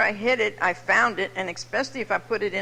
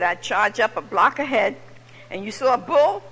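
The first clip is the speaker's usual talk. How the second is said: usual speaking voice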